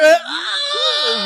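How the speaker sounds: squealing through laughter; laughing shriek. Pretend. Listener mimicking the girl's scream, laughing. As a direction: shriek while cracking up.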